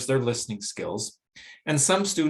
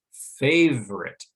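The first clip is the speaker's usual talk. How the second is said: "Favorite" drawn out slowly, first syllable loud; primary stress on first syllable; 3 semitones higher, 5 dB louder, slower than usual. Shown as a demonstration. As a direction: hit the FA of "Favorite" hard and slow. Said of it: "that first syllable receives the primary stress".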